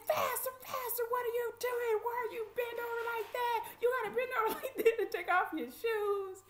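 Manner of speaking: high, loud hollered orders; imitated screaming sheriffs. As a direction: holler commands like a mean sheriff. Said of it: "screaming and hollering at us".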